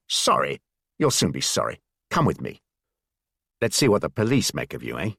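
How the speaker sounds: a big man's cold scorn, dropped low; lightly voiced menacing German guard. A narrator's reading.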